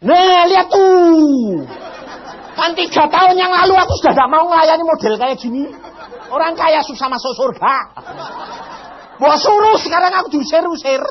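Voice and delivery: hammed-up angry prayer; complaining to God. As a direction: vent at God with mock outrage. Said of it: "saya tuh ngomong dengan Tuhan".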